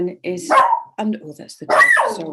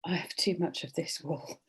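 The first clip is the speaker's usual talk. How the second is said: quiet inner thought; 2 semitones lower, 10 dB quieter than usual. Retold or imitated.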